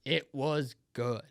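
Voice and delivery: very slow, low, word-by-word "It was good"; savouring. Real, not acted. Host repeating his verdict on dinner, savouring it slowly. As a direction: draw out "good" for emphasis.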